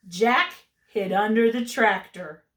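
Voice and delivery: ordinary delivery, nothing marked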